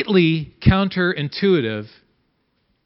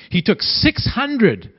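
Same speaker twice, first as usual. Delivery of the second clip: usual speaking voice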